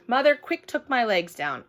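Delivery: ordinary delivery, nothing marked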